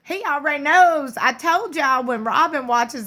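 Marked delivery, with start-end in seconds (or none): 0.0-1.2 high squealed ooh, he already knows; gleeful shocked gossip squeal; shrieking with amused disbelief; mock gasp. Mock gasp at reality show.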